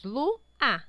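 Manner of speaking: "Lu, a" as two distinct beats; two separate syllables. Shown as a demonstration. Teacher shows sounds coming in two moments, Lu, a syllabified. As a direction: break the word cleanly into Lu, then a. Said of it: "saíram em dois momentos".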